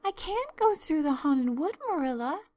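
high, loud, pleading protest; desperate cry. A narrator's reading. Anne begs Marilla not to send her, desperate. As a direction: plead in desperation, pitched well up. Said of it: "cried Anne desperately".

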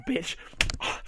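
normal talking voice

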